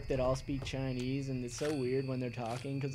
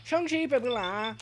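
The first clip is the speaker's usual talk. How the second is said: mock gibberish enemy; 10 semitones higher, 4 dB louder than usual. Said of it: "That's what he just said".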